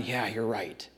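imagined Paul quietly caving; soft, beaten-down surrender; meek giving in. Pretend. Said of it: "Can you imagine if Paul had just gone".

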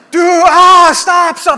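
high, loud panicked shout; freaking-out yell. Retold or imitated. Patient still awake after the countdown, panicked yelling. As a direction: yell to halt everything. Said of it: "I'm freaking out".

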